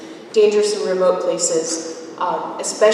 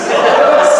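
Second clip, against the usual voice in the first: nervous laugh; 11 dB louder than usual. Real, not acted. Nervous laugh before performing.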